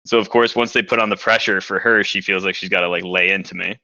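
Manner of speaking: usual speaking voice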